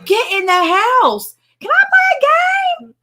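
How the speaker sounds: put-on mom and kid shouting across the yard, high and loud; imitating a mom yelling her kid inside. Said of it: "he's just yelling across".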